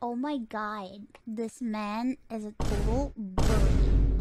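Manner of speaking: usual speaking voice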